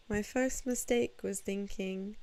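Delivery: ordinary talk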